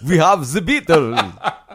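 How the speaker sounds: hammed-up Transylvanian vampire accent, drawn out; Dracula. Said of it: "You sound like a Dracula impersonator".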